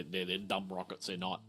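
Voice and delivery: usual speaking voice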